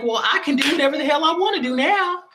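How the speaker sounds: a high, half-crying whoop of liberation; defiant glee. Pretend. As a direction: squeal it like you are finally free. Acting out a defiant inner voice.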